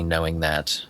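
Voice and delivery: usual speaking voice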